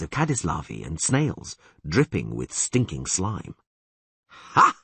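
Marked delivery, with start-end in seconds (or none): 4.3-4.7 loud, triumphant ha; proud exclamation. A narrator's reading. Dandelion shows off his find to Geralt, proud and loud. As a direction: crow over the find. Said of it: "exclaimed again proudly".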